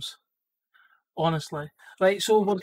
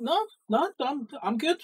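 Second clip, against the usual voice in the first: mock innocent, much higher pitched; fake innocence; 7 semitones higher than usual. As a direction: play it cool but squeaky, guilty. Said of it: "I went".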